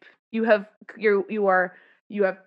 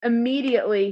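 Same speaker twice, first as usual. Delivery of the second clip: ordinary talk, nothing marked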